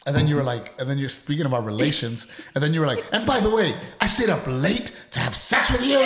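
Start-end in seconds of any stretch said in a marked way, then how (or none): 3.1-6.0 raised, louder hammed-up complaint; mock indignant wife. Husband mimicking wife's complaint about last night, mock indignant. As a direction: throw it out as an exaggerated accusation.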